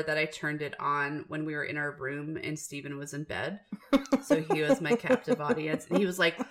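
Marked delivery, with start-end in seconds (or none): none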